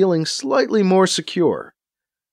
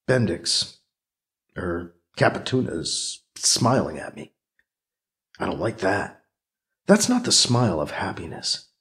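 low, private thinking voice; softer, suspicious aside to oneself; inner monologue; 7 semitones lower, 6 dB quieter than usual. Acted. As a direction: think it to yourself, pitched down and wary. Character's private thoughts.

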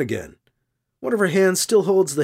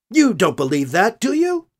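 challenging; 4 semitones higher than usual. A narrator's reading. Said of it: "Ruth challenges him".

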